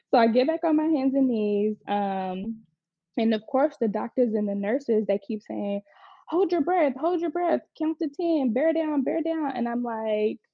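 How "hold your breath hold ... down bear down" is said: higher-pitched mock nagging chant; imitating insistent coaches. Doctors and nurses coaching her through birth, mock insistent. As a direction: chant instructions like pushy delivery staff.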